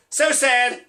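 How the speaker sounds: high, punchy mock sorrow; exclaimed sadness. Comic mocking a tweeted condolence, insincere exclamation. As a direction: blurt it with a big exclamation point. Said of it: "exclamation point".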